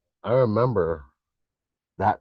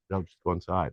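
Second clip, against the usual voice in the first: imitated casual brush-off, softer and lower; nonchalant; 4 semitones lower, 5 dB quieter than usual. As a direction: toss it off like it's nothing. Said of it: "You say this so nonchalantly".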